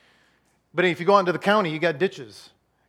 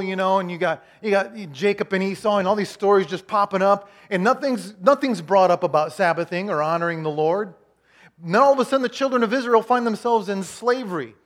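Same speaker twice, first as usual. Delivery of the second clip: usual speaking voice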